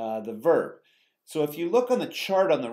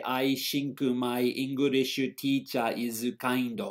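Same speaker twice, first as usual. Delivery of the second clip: slow Japanese-accented English with added vowels; heavy katakana-style Japanese accent; slower than usual. Shown as a demonstration. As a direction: end the words in vowels, like a Japanese learner of English. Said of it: "Japanese person who doesn't speak English very much".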